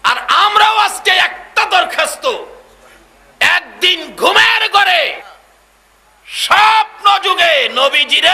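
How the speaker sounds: high, loud drawn-out sermon cry; full-force preaching. Acted. Preacher rousing the crowd to dream of the Prophet, full-force preaching. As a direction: stretch the key words and shout them to the crowd.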